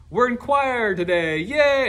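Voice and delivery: a student cheering about choir; excited pupil. Retold or imitated. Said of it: "And they say".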